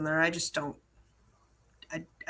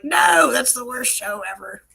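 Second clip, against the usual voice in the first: slow, drawn-out mock kid protest; kids groaning no; 4 semitones higher, 6 dB louder than usual. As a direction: whine it like a bored kid. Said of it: "they're like".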